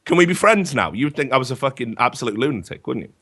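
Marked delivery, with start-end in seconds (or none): 0.0-0.9 lightly raised, breezy admission; cheery confession. Retold or imitated. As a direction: own up as if it were friendly. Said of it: "And I'd go".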